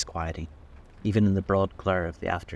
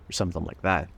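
usual speaking voice

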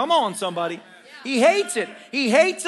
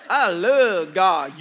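slow, drawn-out "I love God"; drawled slang; 2 semitones lower, 6 dB louder, much slower than usual. Shown as a demonstration. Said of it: "everybody's be like".

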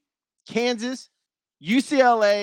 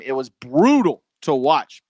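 ordinary talk

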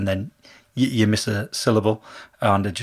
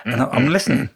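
ordinary delivery

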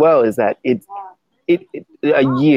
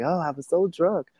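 hungover groan; 7 semitones higher, 7 dB quieter than usual. Retold or imitated.

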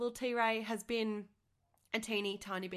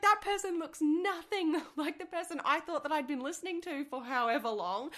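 surprised; 6 semitones higher, 4 dB louder than usual. Retold or imitated. Retold shock at a photo.

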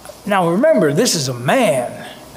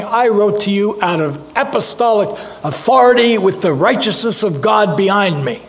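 raised, louder, commanding as if speaking from authority; mock apostolic authority; 4 semitones higher, 4 dB louder than usual. Preacher mocks the proud letter Paul never wrote, commanding. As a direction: proclaim it like a confident apostle. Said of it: "Apostle Paul should be saying".

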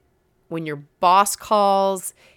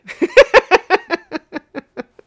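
big laugh; 14 semitones higher, 11 dB louder than usual. Real, not acted. Laughs at own slip.

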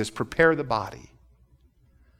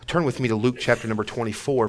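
ordinary talk, nothing marked

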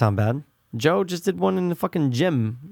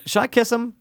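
high voice; 9 semitones higher than usual. Retold or imitated. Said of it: "Remember when I said".

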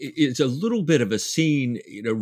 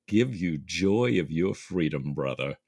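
quiet tender; 6 semitones lower, 6 dB quieter than usual. A narrator's reading. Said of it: "Jack turns to Stephen and says".

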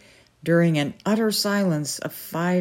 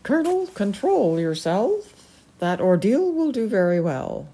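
haughty falcon lady; 4 semitones higher than usual. Acted. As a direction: quiz him like a stern noblewoman. The Peregrine speaks.

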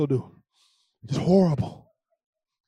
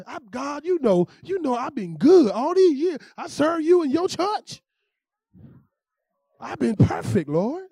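higher, louder, slow complaint to God; mock self-righteous whine; 9 semitones higher, 7 dB louder, slower than usual. Believer complaining to God about being good, whiny. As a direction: whine your good record at the Lord. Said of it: "how we do this with God sometimes".

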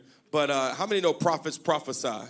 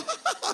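very high squeaky giggle; imitated giggle; 4 dB quieter than usual. Pastor imitating a member's laugh, high squeaky giggle. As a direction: mimic a congregant's laugh. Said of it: "her teeth go".

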